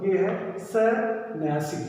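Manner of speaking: सन्यासी said slowly, syllable by syllable; careful pronunciation. Shown as a demonstration. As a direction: spell out सन्यासी slowly and clearly. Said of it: "इसका आप उच्चारण करो".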